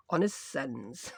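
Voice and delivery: slow, low, soft "as sens" in Yorkshire; Yorkshire "ourselves". Shown as a demonstration. Crafter repeating the dialect phrase for viewers, "as sens" in slow Yorkshire. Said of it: "That's how we say ourselves in Yorkshire".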